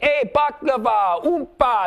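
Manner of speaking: high-pitched, drawn-out heckle at the crowd; hammed-up crowd-work yell; mock yelling out. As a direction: holler nonsense to get their attention. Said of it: "I start yelling out".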